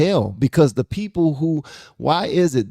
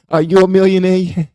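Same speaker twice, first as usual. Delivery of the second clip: high, loud question through a laugh; laughing challenge; 8 semitones higher, 7 dB louder than usual. Real, not acted. Host asking longtime workers about their pay, laughing. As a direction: tease with a laughing, raised voice.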